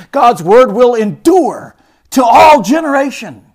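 impassioned sermon climax; full-force preaching. Acted. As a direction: drive it home from the pulpit.

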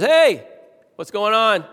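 loud, high call down to the basement; dad calling down the stairs to his quarrelling boys, loud and high. Retold or imitated. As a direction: shout down to stop the kids' fight, half alarmed. Dad calls down to fighting boys.

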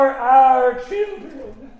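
usual speaking voice

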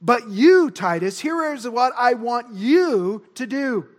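loud stress on "you"; emphatic direct address. Acted. Paul turning to Titus after false teachers, emphatic. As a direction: punch "you" to turn the focus onto the listener. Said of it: "emphasis Paul is making here to Titus".

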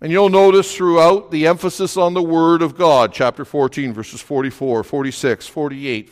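normal talking voice

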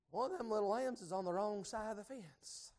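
softly to himself, under his breath; quiet aside. Retold or imitated. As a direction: muse it quietly, almost to yourself.

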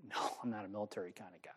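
whispered, shrugging off the idea; whispered reply. Retold or imitated. Young man whispering his refusal to his uncle. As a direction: breathe it out under the voice. Uncle suggests joining the military.

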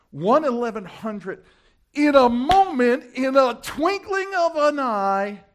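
loud, high, ringing proclamation; full-force preaching. Real, not acted. Preacher proclaiming the resurrection moment, full-force and high. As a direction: build to a drawn-out, high shout on the verse.

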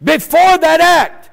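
high, loud, emphatic sermon delivery; full-force preaching. Real, not acted. Preacher telling the congregation God will bless them, impassioned preaching. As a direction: preach it out with power to the whole room.

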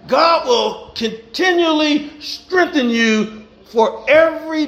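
rhythmic preacher cadence, building; preaching. Acted. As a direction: preach it to the congregation with fire. Preaching to congregation.

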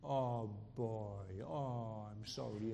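soft, low, cooing comfort; gently mocking a coddling comforter; mock soothing. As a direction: pat him on the head with your voice. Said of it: "God doesn't come by to him and says".

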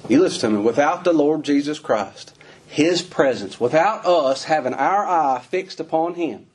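usual speaking voice

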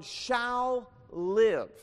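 "shall live" punched loud and high, drawn out; stress on shall live. Shown as a demonstration. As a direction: hit "shall live" hard, rising then falling. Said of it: "the emphasis in Habakkuk is on".

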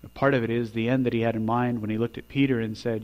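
ordinary talk

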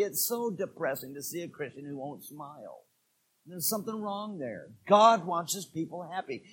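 sudden quiet. Real, not acted. Preacher drops voice.

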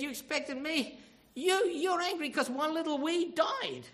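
high, loud mock disbelief; incredulous rebuke. God rebukes Jonah over one dead weed, loud incredulous rebuke. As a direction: scold in disbelief, slowing on the absurd reason.

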